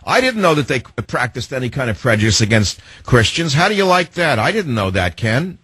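mock surprise, feigned innocence; sarcastic. Real, not acted. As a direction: play naively shocked, dripping with sarcasm. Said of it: "I'm just being sarcastic".

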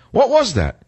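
high startled question, re-living it; mock-startled what was that; retold surprise. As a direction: jump up in pitch, bewildered. Startled by shock in MRI.

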